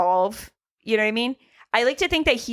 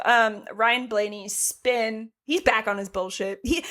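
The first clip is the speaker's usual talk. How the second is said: normal talking voice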